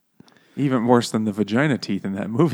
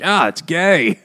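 mocking brother; 8 semitones higher, 7 dB louder, slower than usual. Mid-nineties brother mocking sister.

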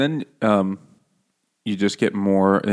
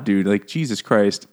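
normal talking voice

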